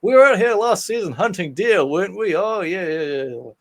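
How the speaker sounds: high, loud folksy yokel voice; chuckling small-town cop, not serious. Retold or imitated.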